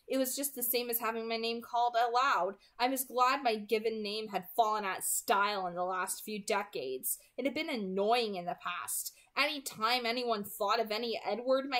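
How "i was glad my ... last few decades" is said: ordinary delivery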